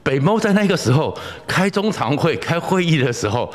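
usual speaking voice

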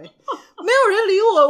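laughing through a mock sob; mock wail. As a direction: wail it, half-crying half-laughing. Nobody comforted crying child.

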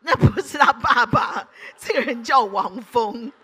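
laughing. Real, not acted. Laughing at funny photo.

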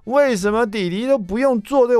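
put-on very high, stretched-out kid whine on 为什么弟弟都不用做这个; whiny child. Father imitating son Jason's complaint, high whiny. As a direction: drag out 为什么弟弟都不用做这个 like a sulky kid. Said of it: "我又听到Jason说".

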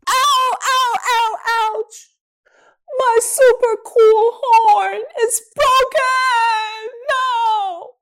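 squeaky wailing dinosaur. Acted. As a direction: wail high over the broken horn. Third dinosaur breaks his horn.

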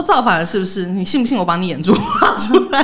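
mock threat breaking into laughter; a hint of a bossy kid, laughing; laughing through the threat. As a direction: try to sound tough but laugh it out. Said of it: "我真的没有办法不笑讲完".

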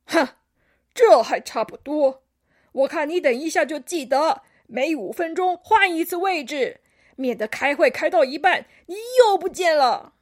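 loud, huffy grandpa nagging; emphatic cranky scolding; grumpy old koala. A narrator's reading. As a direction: lecture crossly in a loud elder voice. Old koala grumbles at chameleon.

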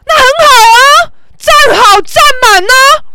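high, drawn-out sarcastic yell; shouting. Retold or imitated. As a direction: scream it back at him, stretching the ends. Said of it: "我大叫啊". (扯着嗓子大叫回呛)

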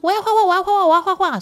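put-on high, loud chanting of a rowdy class of kids; clamouring kids. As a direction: mimic a whole class begging to draw. Whole class clamouring to draw. (模仿全班小孩吵着要画画)

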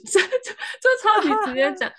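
laughing through the words, slow and high. Real, not acted. Laughing to a friend about the blunt comeback. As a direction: giggle while saying it.

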